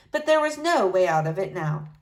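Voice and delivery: plain reading voice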